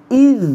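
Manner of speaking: ইজ said high and loud with tongue tip on the teeth; a sharp, high example syllable; demonstrated sound. Shown as a demonstration. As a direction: stress ইজ clearly for the class. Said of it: "জিহ্বার আগা উপরের দাঁতের আগার সাথে লাগিয়ে".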